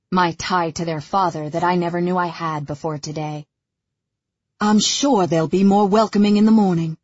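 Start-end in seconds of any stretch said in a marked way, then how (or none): 4.6-7.0 louder, insistent reassurance; Callum, convincing himself. A narrator's reading. Father reassuring the newcomer about his sons, trying to convince himself. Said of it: "sounds like he's trying to convince himself".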